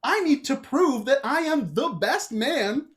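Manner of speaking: determined suitor. Acted. Dan vows to be her best partner, in hammed-up resolve. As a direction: puff up to win her over. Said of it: "You go".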